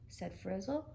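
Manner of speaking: plain reading voice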